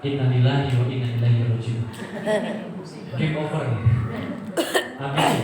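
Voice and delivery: low, resigned muttering to oneself; inner voice. Retold or imitated. Son caught by his father thinks to himself, low inner voice. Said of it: "dalam hati saya".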